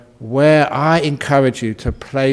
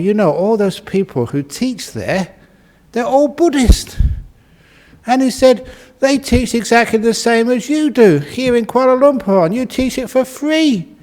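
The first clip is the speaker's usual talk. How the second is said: high-pitched, astonished retelling; amazed young man; 10 semitones higher, 3 dB louder than usual. Retold or imitated. Rich young man tells his teacher what he found at the clinic, amazed. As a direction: voice climbing high in mock amazement.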